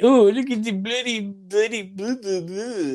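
mock British bloke. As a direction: ham up a cheeky English voice. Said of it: "How in the hell did bloody become a expression".

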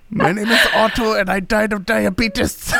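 high, laughing mock ad read; commercial spokesman voice. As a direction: ham up an old man in an ad. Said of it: "like the old dude on the commercials".

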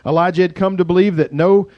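ordinary delivery

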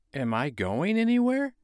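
put-on high wondering boy; a child, surprised; little boy voice. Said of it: "asked the child".

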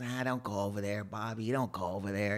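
lightly voiced old drinker warning his buddy; quiet old man. Old man at the picnic table warning his friend, low and hushed. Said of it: "And he goes".

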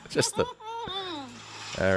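very high, very slow squeaky doughboy; cartoon doughboy try. Shown as a demonstration. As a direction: stretch it out in a tiny dough voice. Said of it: "what's that voice?".

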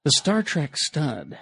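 slow, sultry, breathy reading; sexy breathy voice. Pretend. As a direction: read it like a seduction. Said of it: "read this in kind of a sexy, breathy voice".

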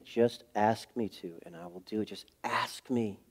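reassuring. Retold or imitated. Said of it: "he kept reassuring me".

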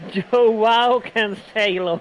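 very slow mock-English pronunciation; exaggerated English accent. Savouring the absurd guide pronunciation, exaggerated English accent. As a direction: drawl the name with English vowels. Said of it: "veramente fa abbastanza senso".

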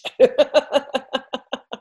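a burst of high laughter at her own joke; high bright laugh. Real, not acted. Laughing at her own cheeky catchphrase, high laugh.

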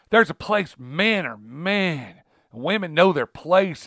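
slow imitation of a southern preacher; southern accent. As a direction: mimic a Southern US pastor, drawn out and preachy. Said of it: "you know, he had a southern accent".

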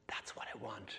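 hushed whisper of relief; whispered to himself. Retold or imitated. Telling the congregation his thought after confession, whispered. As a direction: breathe it out under your breath.

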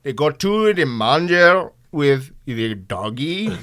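put-on slow, high Indian-accented priest. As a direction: preach it slowly in an Indian accent. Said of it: "Father Philip put it something like this".

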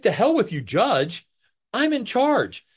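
high, haughty imitation of her defiance; mock arrogant defiance. Witness mocking the judge, haughty and defiant. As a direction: sneer it like you own the room. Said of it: "It's like".

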